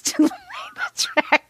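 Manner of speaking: words broken by giggles; reading through laughter; laughing. Real, not acted. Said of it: "can't say this without laughing".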